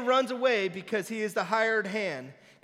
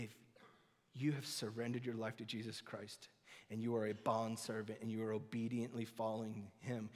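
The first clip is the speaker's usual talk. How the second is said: tender; 8 semitones lower, 9 dB quieter than usual. Acted. Tender appeal after the whisper.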